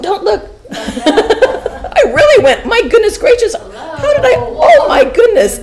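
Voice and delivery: real giggle at her own slip; laughing. Real, not acted. Laughing at her own slideshow mistake to the class. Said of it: "How funny".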